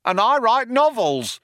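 the other cop chiming in; second cop chiming in. A narrator's reading. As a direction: chime in brightly. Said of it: "chimed in the other cop".

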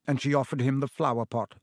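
ordinary delivery, nothing marked

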